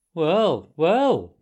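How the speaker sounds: loud mild exclamation; a gruff little exclaim; Mole voice. A narrator's reading. Mole reacting.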